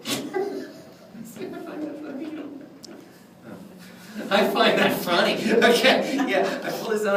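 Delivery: high, laughing through the words; real laughter breaking up the speech. Real, not acted. Lecturer tickled by his own wand bit, laughing.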